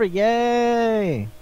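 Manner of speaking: put-on celebration; mock cheer. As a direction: give a playful little cheer. Cheering the show's coming end.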